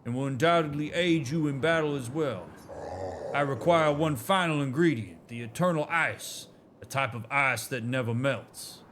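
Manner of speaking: light Southern drawl for a storytelling elder; a hint of a Southern drawl, proud and nostalgic. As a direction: read it like a Southern elder recalling old glories. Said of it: "it's fun doing that voice".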